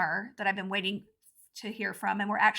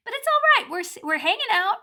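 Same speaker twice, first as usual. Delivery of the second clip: squeaky cheer; 10 semitones higher, 6 dB louder than usual. Acted.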